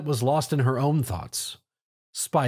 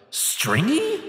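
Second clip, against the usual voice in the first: offended disbelief. Acted. Tom offended at the insult.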